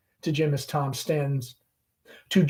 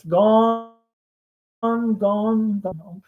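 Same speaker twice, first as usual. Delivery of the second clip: fading wistful gone; 6 semitones higher, 3 dB louder, much slower than usual. Acted. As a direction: let it fade into regret. Amanda mourning lost gentility.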